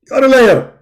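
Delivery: high, loud, indignant preacher voice; Zakir Naik impression. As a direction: bark it like a self-righteous debater. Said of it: "My name is Zakir Naik".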